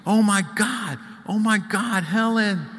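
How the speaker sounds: high panicked scream of a name; girl screaming for her sister. A narrator's reading. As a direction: shriek it, breathless and frantic. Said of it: "sweaty, and screaming".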